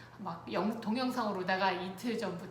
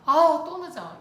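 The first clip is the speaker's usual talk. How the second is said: loud, high burst of annoyance; exasperated complaint; 7 semitones higher, 12 dB louder than usual. Retold or imitated. Worn-out wife to late husband, exasperated. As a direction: blurt it out as a re-enacted gripe. Wife's irritated words blurt out.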